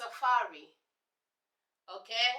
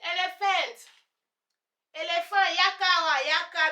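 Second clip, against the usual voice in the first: loud, higher call to the elephant; commanding the elephant; 6 semitones higher, 6 dB louder than usual. Pretend. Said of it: "because I'll command".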